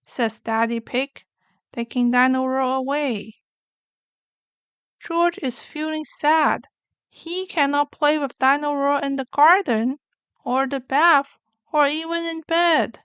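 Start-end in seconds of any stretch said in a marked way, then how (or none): none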